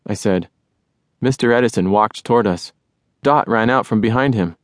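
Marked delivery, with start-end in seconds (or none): none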